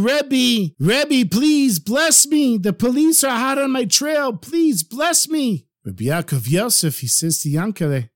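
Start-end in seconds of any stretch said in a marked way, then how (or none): 0.0-5.7 high, desperate cry for help; pleading shout. Acted.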